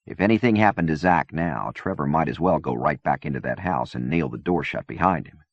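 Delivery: plain reading voice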